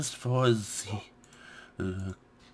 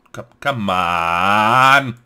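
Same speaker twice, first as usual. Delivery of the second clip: impatient; 3 semitones lower, 13 dB louder, much slower than usual. Real, not acted. Waiting on the game.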